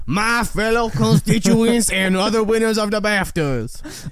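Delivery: high, slow Southern belle drawl; Southern debutante accent. Retold or imitated. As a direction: address the room as a grand Southern debutante. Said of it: "Please read in your Southern debutante accent".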